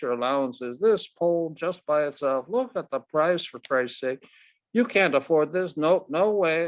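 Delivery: put-on a brash old ballplayer talking down to a boy; gruff Ted Williams. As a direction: brush the kid off, then lay out the facts. Narrator voices Ted Williams.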